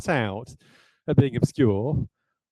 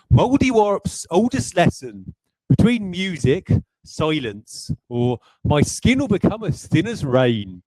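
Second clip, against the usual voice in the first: slow, higher, broad West Country accent reading; 5 semitones higher than usual. Shown as a demonstration. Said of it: "I'm gonna say this in a pronounced West Country accent".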